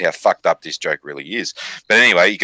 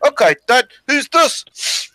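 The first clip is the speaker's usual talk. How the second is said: very high mock Maori accent, louder start; chief's son; 3 dB louder, slower than usual. Son testing dad a last time, high mock Maori accent. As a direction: push the son's Maori voice up high. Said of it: "he goes".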